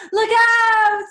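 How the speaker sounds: high, loud "look out!" holler; big urgent exclamation; shouted warning. Shown as a demonstration. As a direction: yell a warning to a friend in danger. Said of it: "I would shout it".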